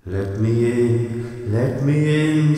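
long, drawn-out ghostly wail, loud; chanting spook, each word stretched out; spooky voice. A narrator's reading. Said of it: "said Percy in a spooky voice".

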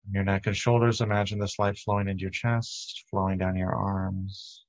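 unhurried, even meditation voice with long pauses; steady, calming body-scan delivery; guided meditation. Real, not acted. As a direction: guide listeners gently through breath and body.

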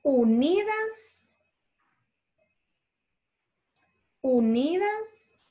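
fuertemente drawn out slowly, word by word; slow dictation. Shown as a demonstration. Teacher dictating slowly to children copying notes. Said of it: "Les puedo ir leyendo despacito".

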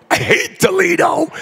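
playful rival yell; mock hatred shout. Real, not acted. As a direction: roast Toledo with a yell. Rivalry rant at banquet.

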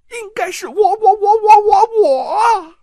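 high voice building to a loud cry on each 我; hysterical insistence. Acted. Jealous aunt shrieking that he must love her.

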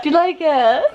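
ordinary talk

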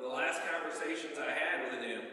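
usual speaking voice

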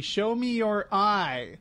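mock divine command, stretched out slowly; retold yell. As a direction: imitate a slow, commanding yell. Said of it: "he yells at the lady".

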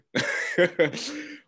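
a short, high, breathy laugh; amused little laugh; light laugh. Real, not acted. Laughs before answering own question.

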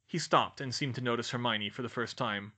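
plain reading voice

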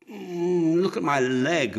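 high, loud groaning complaint; moaning. A narrator's reading. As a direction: moan it out in pain. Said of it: "moaned Horus".

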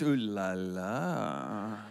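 low, very slow mock-suave French purr; teasing, deep and drawn-out; playful ooh la la. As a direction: draw it out like a hammed-up French flirt. Playful reaction to wife being French.

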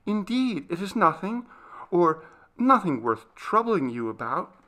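high, hesitant denial; nervous servant girl, pitched high; scared, faltering. Acted. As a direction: timidly brush the question away. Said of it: "looking still more scared at his question".

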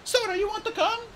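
put-on high, bright young dragonette voice; chirpy excited young dragon; eager little RainWing voice; Kinkajou's voice. Kinkajou asks a classmate.

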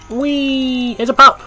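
high, excited squeal at spotting the pup; thrilled little cry, then loud and high; delighted squeal. Real, not acted. Spots a cute pup robot.

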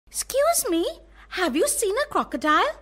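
high, quick, polite little voice; a small elephant asking very politely. Acted. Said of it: "asked the little elephant most politely".

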